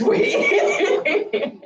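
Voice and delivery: high-pitched, breaking into laughter; laughing. Real, not acted. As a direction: laugh through the words. Laughs while teasing the story.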